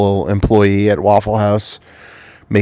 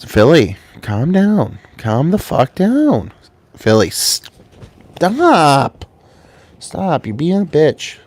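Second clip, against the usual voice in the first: higher, drawn-out scolding of a pet; scolding the dog; 7 semitones higher, much slower than usual. Real, not acted. Owner to restless dog Philly, stern scolding. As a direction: tell the dog off, slowly and firmly.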